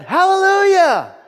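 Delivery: high, full-voiced shout of praise. Boy caught up in the preaching, shouting. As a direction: belt it out, overcome with fervour. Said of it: "The boy shouted".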